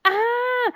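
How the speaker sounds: high, drawn-out mock scream; sustained "aah" of retold panic; freaked-out scream. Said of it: "freaking out 'cause I'm like".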